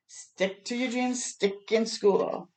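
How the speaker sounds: put-on slow, earnest advice to children; lightly voiced old Elijah, slow; a hint of a kindly old inventor; encouraging old man.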